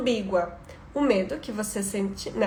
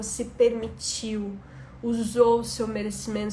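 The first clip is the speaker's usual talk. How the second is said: guided reflection. Acted.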